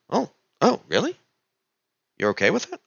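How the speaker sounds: high, lightly voiced surprised guard; mock surprise. Guard surprised the traveller lets him confiscate it, mild mock surprise. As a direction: sound caught off guard.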